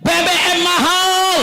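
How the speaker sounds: high, slow, stretched-out cry; people's protest cry. Acted. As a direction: call out the grievance like a street protest. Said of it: "Rakyat teriak". (teriakan protes, tinggi dan diulur)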